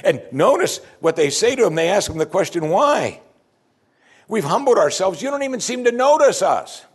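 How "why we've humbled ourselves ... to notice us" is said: aggrieved complaint. Acted. Voicing the people's complaint to God.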